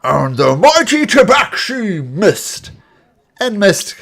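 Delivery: put-on booming old orc addressing the crowd; grand proclamation, slow and loud; elderly orc announcer.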